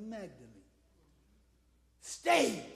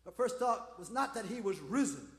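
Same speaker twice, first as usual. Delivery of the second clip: normal talking voice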